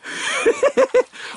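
high burst of laughter; big laugh. Real, not acted. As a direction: crack up at a friend. Friend's antics at fishing spot.

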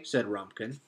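usual speaking voice

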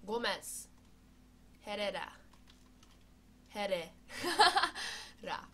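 lively match commentary; sports commentary. Pretend. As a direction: call the chance on goal. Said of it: "én vagyok a kommentátor".

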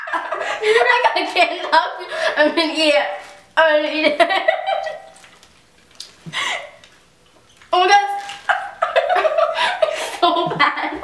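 high, laughing disgust. Real, not acted. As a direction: laugh through the gross taste.

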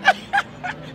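high breathy laugh; scornful laugh. Acted. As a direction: laugh at him. Mocking laugh.

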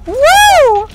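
loud, very high falsetto woo; long high whoop as you flee; high-pitched whoop. Real, not acted. Fleeing a game enemy, loud high whoop.

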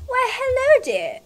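high swooping granny greeting; hammed-up old granny. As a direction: greet them like a granny, starting high and falling away. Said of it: "I can do my granny voice".

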